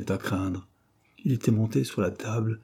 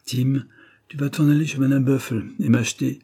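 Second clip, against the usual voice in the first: raised-voice errand order; calling out order; 5 semitones higher, 5 dB louder than usual. A narrator's reading. Wife sending husband to the shop, raised voice. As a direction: call him to the task briskly.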